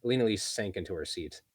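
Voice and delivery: plain reading voice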